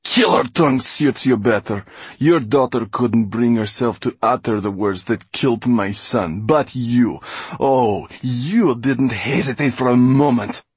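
high, loud villainess tirade; bitter accusation. Acted. Mortola blaming Mo for her son's death, venomous. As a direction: accuse with venom, surging on the last clause.

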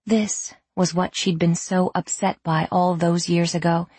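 plain reading voice

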